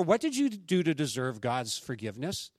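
usual speaking voice

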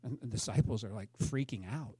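soft and low, still hushed; hushed telling. Acted. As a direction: keep it quiet, almost secretive.

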